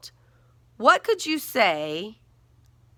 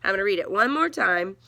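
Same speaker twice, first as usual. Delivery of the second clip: ordinary talk, nothing marked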